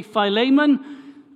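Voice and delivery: "Philemon" with an "ay" in the stressed middle; long-a pronunciation. Shown as a demonstration. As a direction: say "Philemon" as fie-LAY-mun. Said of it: "some may say".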